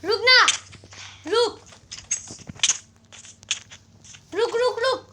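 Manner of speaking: loud stop command. Real, not acted. Friend shouting at player to stop, loud commands. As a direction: bark at him to stop.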